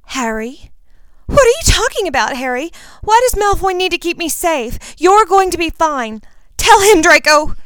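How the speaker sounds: high, loud, rushed pleading; Ginny begging Draco to reassure Harry; hysterical girl. Acted. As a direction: refuse to believe it.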